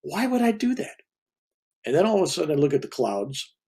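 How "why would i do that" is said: high, incredulous; incredulous question. Retold or imitated. To the guru, refusing in high disbelief. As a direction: toss it back in disbelief. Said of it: "And I'm going".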